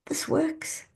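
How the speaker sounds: hushed, high whispered amazement; awed whisper. Retold or imitated. Clients back from the money walk, awed whisper. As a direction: breathe it out in wonder. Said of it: "they see the results and they go".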